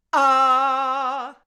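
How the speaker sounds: glottal attack on Ah, loud and sudden; hard onset. Shown as a demonstration. Teacher demonstrating a hard onset on Ah. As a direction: burst the Ah open from a held breath. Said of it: "and then sing on ah".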